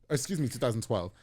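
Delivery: normal talking voice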